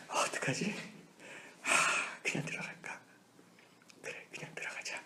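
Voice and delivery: whispered inner doubt, voice dropping away; inner dilemma. Retold or imitated. Pastor reliving hitting a car, whispering his temptation. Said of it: "마음에 갈등을 했습니다".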